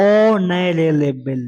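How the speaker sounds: অনারেবল drawn out very slowly with no h sound; teacher slowly sounding out অনারেবল without the h; silent h, slowly spelled out. Shown as a demonstration. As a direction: stretch out অনারেবল syllable by syllable, silent h. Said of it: "উচ্চারণটা, এজ কিন্তু এখানে উচ্চারিত হয় না".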